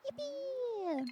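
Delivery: high, drawn cheer; squeaky yippee. Real, not acted. Streamer thanking a viewer for bits, high squeaky yippee. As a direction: squeal it gleefully.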